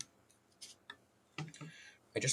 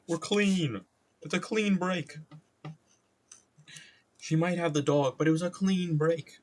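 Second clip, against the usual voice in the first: higher-pitched, insistent put-on voice; putting on a breakup spiel; 8 semitones higher than usual. Someone explaining the clean break, insistently high. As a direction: insist on it in a raised voice.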